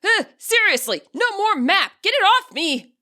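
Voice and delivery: high, loud panicked shout; panicked yell. A narrator's reading. Arvin yelling at the phone stuck to his face, panicked shout. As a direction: yell in panic to get it off you. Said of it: "Panic sets in and he yells".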